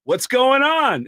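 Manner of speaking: eager question. Retold or imitated. Comedian asking strippers eagerly, high and drawn out. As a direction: ask it hopefully, stretched out. Comedian's own reaction retold.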